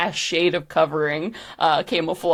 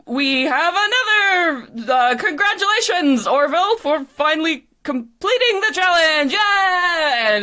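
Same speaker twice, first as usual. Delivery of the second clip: high-pitched, excited announcer; hammed-up MC congratulations, pitched up; show MC; 10 semitones higher than usual. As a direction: announce the winner like a game show host. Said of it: "the M C stops the replay".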